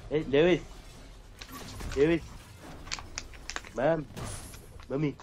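bad cartoon baby impression; lightly voiced Stewie calling mom. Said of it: "It's a bad Stewie impression".

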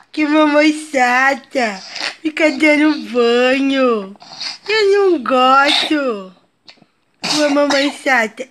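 put-on slow, whiny pouting child; filha emburrada. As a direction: sulk slowly like a grumpy little girl. Mãe faz a voz da filha emburrada. (voz lenta e manhosa de criança emburrada)

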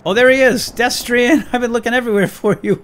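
high, loud delighted hail; excited greeting. Pretend. Finally finding Destrian after searching, loud excited greeting.

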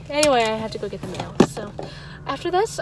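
normal talking voice

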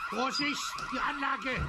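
sharp high alarmed yell of warning; alarmed shout. Acted. Husband yells at wife near the alarm system, alarmed. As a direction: cry out to stop her in time.

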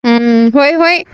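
normal talking voice

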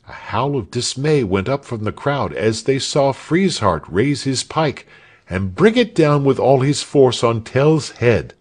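ordinary delivery

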